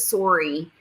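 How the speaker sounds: "Sorry" rhymed with "story"; Canadian "sorry". Retold or imitated. Telling chat what makes her laugh, Canadian "Sorry". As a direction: mimic the Canadian "Sorry", SORE-ee. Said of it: "She always says".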